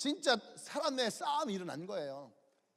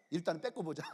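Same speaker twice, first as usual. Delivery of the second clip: low, soft aside; understated plotting voice; low-key scheming; 5 semitones lower, 6 dB quieter, faster than usual. Retold or imitated. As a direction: float the plan quietly, matter-of-factly. First take of the elders' decision.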